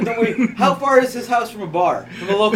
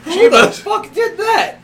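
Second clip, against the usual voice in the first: high, drawn-out laughing yell; laughing startled shout; 8 semitones higher, 5 dB louder, much slower than usual. Pretend. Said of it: "I was like".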